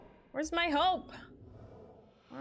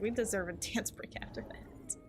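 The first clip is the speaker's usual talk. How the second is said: whispering; 5 dB quieter than usual. Real, not acted. Whispered laugh.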